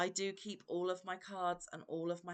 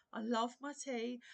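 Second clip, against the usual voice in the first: usual speaking voice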